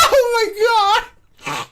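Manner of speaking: slow, high words through laughter; laughing hard. Real, not acted. Player laughing at the joke, slow laughing words. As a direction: gasp it out while cracking up.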